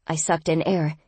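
ordinary delivery

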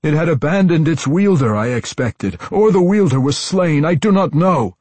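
frantic explanation. A narrator's reading. Tosin defends himself to the matron, frantic.

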